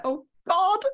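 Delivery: high, dismayed "Oh, God"; exasperated groan. Retold or imitated. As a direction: caught out and mortified. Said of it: "And I was like".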